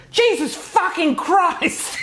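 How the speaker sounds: high mock yell through laughter; imitating a yell. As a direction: shriek it, half laughing. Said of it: "yelled out".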